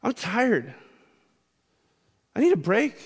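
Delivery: tired disciples complaining to Jesus, whiny and exasperated; whiny exhaustion. Retold or imitated. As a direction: moan like a worn-out follower begging for rest.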